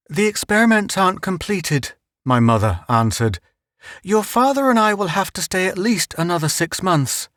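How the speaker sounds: a hint of a worried mother; mother explaining to her son. Mother voiced in story.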